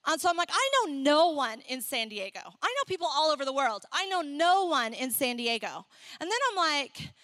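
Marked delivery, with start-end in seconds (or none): none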